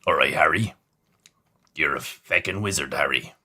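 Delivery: low, rumbling Hagrid voice with an Irish lilt; Hagrid impression. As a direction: boom it out like the gentle giant Hagrid. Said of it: "not a bad Hagrid impersonation".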